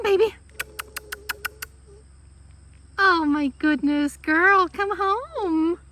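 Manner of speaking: high, sing-song calling to a dog; coaxing a pet. Real, not acted. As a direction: coax a scared dog closer.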